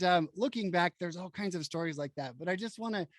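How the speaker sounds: ordinary talk, nothing marked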